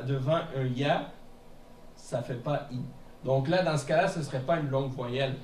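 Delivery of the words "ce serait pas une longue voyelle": ordinary talk, nothing marked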